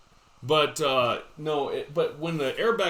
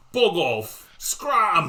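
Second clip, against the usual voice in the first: mock English accent, ending in a loud, high shout on scram; a storybook Brit shooing someone off in a dodgy English accent; hammed-up English accent; bad English accent; 4 semitones higher, 6 dB louder, much slower than usual. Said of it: "my English accent".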